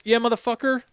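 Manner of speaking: high, sarcastic comeback; cocky mock retort, pitched high; defiant retort. Mocking retort to taunting Marines.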